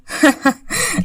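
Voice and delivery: short high mocking laugh; acted dismissive chuckle; scornful laugh. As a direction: laugh derisively at the very idea. Husband scoffs at the idea.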